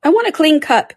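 high, loud demand; abrupt, high-voiced announcement; interrupting. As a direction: cut in loudly, pitched high. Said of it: "interrupted the Hatter".

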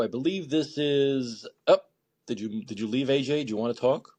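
ordinary delivery

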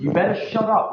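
high, loud angry shout. Real, not acted. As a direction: yell at them to shut up. Heated argument, telling to shut up.

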